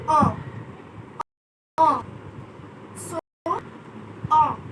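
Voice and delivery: UN as a nasal French vowel, no N. Shown as a demonstration. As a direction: say ON through the nose, French style. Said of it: "is the nasal sound".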